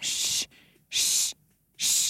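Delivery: repeated steady shh sound; imitated shushing. Imitating the woman in the YouTube shushing clip, steady shh. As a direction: imitate a looped recording of hushing. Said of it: "Niin kuuluu naisen äänä semmoinen".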